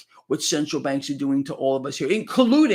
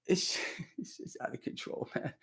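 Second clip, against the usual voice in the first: breathless, voiceless laugh through the words; 15 dB quieter than usual. Real, not acted. Host cracks up at the politicians, laughing breathlessly. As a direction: laugh so hard the voice drops out. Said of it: "I'm laughing".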